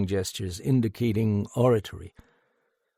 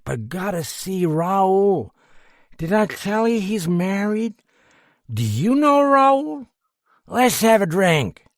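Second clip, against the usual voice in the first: put-on mollified drunk; 10 semitones higher, 6 dB louder than usual. A narrator's reading. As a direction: ramble sentimentally about a friend. Drunk rambles about Raoul.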